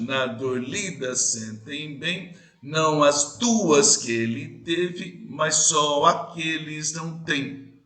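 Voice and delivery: slow scansion, marking the third syllable; stress on the third syllable. Shown as a demonstration. Teacher to class, scanning the verse slowly. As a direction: read the lines slowly in their rhythm. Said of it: "Na terceira sílaba é mais forte".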